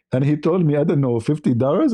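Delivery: normal talking voice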